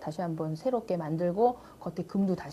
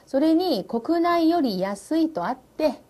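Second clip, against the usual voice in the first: very slow, higher Japanese line; very slow reading; 7 semitones higher, 3 dB louder, much slower than usual. Shown as a demonstration. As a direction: spell it out slowly and high. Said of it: "teacher reads model line".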